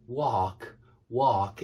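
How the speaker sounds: "walk" with an American ar-sound, slow. Shown as a demonstration. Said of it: "that's my best effort at it".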